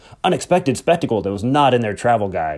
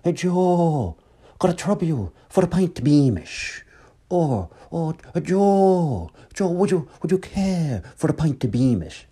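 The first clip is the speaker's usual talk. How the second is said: lilting Irish pub voice, higher; playful Irish accent asking for Beamish; Irish brogue; 6 semitones higher, slower than usual. Retold or imitated. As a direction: imitate a brother's Irish brogue ordering a pint. Brother imitating Tony's Irish request.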